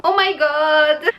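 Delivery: high, drawn-out amazed cry; astonished. Real, not acted. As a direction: stretch it out in real astonishment. Surprise on opening a gift. (stupéfaite, exclamation étirée)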